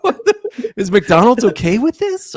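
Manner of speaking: high laughing what the; laughing disbelief. Real, not acted. Cracking up while ranting about the McDonald's scene, high laughing. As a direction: break into a squeaky laugh mid-word.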